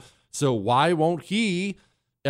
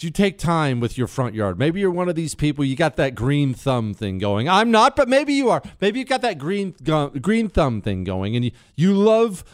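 normal talking voice

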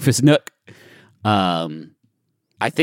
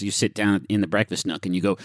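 usual speaking voice

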